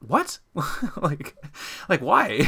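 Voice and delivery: a sudden squeaky high "What?"; mock surprise. High shocked disbelief. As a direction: friend quotes you back, replying in high shocked disbelief.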